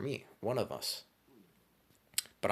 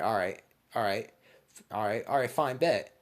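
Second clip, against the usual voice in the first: rising, louder run of agreement; mock fed-up acceptance; 2 semitones higher, 5 dB louder than usual. Storyteller accepting a fight after being insulted, mock fed-up. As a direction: build up to taking the challenge.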